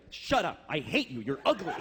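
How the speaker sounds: ordinary delivery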